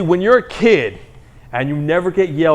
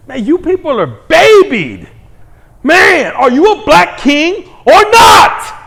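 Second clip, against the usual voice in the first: high, loud mocking yell; mock shout; 9 semitones higher, 6 dB louder than usual. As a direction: yell it up high like a fed-up uncle.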